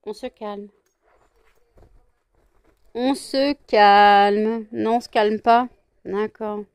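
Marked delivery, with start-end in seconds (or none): 0.0-0.7 quiet, hushed command; calm them with a soft voice; soft settling. Real, not acted. Owner settling both pets, soft hushed order. 2.9-4.7 loud, very drawn-out command; insistent settling. Real, not acted. Owner settling both pets, long drawn-out loud order.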